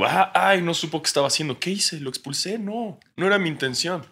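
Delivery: high, soft, embarrassed fluttering; flustered swooning referee. Retold or imitated. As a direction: play it shy and flustered, like a crush caught out. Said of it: "Y, y el árbitro".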